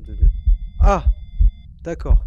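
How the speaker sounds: real, very loud startled cry; startled yelp. Real, not acted. Player jumps as the ghost reacts, startled yelp. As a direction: jolt with a sudden loud yell.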